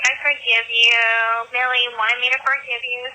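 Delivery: usual speaking voice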